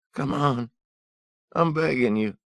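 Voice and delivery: high, loud desperate begging; a ragged thief pleading at gunpoint. Acted. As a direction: beg for your life in a strained high voice. Thief pleading for his life.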